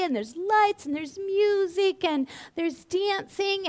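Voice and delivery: dreamy romantic gushing. Retold or imitated. Dreamily listing romantic bay scene.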